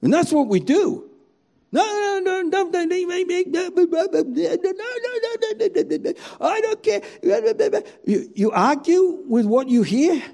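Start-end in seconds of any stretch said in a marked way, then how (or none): 1.7-7.0 put-on high, insistent childish no-no-no; a child covering its ears, higher and higher; stubborn refusal. Voicing people refusing the word.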